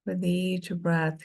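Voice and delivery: ordinary talk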